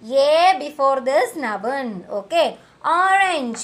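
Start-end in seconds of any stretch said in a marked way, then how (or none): none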